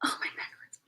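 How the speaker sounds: whispered complaint. Real, not acted. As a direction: breathe it out unvoiced. Muttering to herself.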